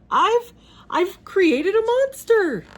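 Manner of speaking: high, loud, slow stammer of horror; horrified gasp. A narrator's reading. Inventor pig realizing he built a monster, horrified. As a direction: gasp it out in terror. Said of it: "Doctor Pig gasped".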